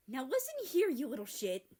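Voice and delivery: loud, high mock-menacing snarl; mock threat. America threatens his teasing brother, loud. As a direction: threaten your little brother.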